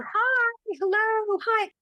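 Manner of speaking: high, eager calling up the stands; imitating calling out. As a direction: mimic fans calling down from the seats behind. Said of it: "these ladies behind us kept yelling down".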